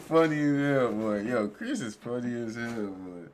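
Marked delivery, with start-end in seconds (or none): none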